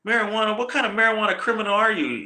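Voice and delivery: high, quick mock disbelief; dumbfounded lawman, retold; incredulous questioning. Acting out marshal's incredulous questioning.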